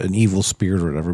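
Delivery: ordinary delivery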